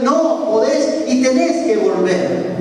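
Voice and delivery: high, slow, insistent reply; firm insistence. Retold or imitated. Pastor answers the robber's refusal, acted firm insistence. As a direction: overrule him, spacing it out.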